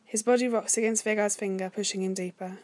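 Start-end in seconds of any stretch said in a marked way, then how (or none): none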